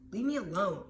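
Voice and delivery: put-on loud, sullen yell from an upset kid; angry student. Upset student yells at the teacher, loud and angry. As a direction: shout it back at the teacher.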